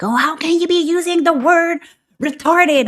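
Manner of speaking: high, loud outraged mock voice; hammed-up indignant scold; mocking woke critic. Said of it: "woke peoples are gonna lose their shit on me and like".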